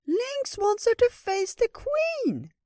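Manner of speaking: very high, slow-stretched squeak; anxious little critter. Acted. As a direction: blurt the alarm in a tiny squeaky voice. Anxious Ratatosk explains in squeaky voice.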